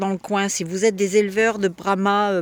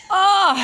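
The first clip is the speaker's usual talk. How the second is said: sudden high, loud cry of pain; short sharp yelp, real; 12 semitones higher, 7 dB louder than usual. Real, not acted. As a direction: yelp as the claw grips. Hen's claw grabs her finger.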